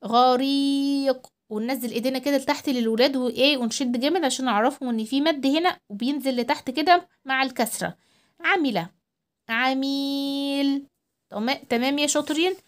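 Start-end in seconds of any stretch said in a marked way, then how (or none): 0.0-1.3 غريق with a held vowel; long ii in غريق. Shown as a demonstration. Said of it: "المد بقى نطول كأننا بنشد السلايم". 8.4-8.9 quick kasra in عملة; short i in عملة. Shown as a demonstration. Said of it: "الكسرة حركة قصيرة". 9.5-10.9 عميل with a held vowel; long ii in عميل. Shown as a demonstration. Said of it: "المد صوته بيبقى طويل".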